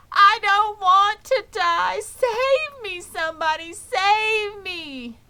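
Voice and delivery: high, loud, slow crying plea; terrified pleading. Acted. Wilbur begs the barn to save him, crying high and loud. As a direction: beg for your life. Terrified pig begging for life.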